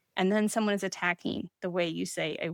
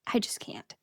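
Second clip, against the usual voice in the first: helpless whisper; 6 dB quieter than usual. Real, not acted. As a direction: trail into a soft whisper. Admitting defeat over pronunciation.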